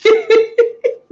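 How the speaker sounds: high burst of laughter; real laugh. Real, not acted. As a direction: crack up at the memory. Laughing at the memory.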